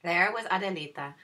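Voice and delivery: plain reading voice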